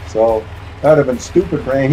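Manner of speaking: ordinary talk, nothing marked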